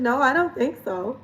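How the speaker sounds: ordinary delivery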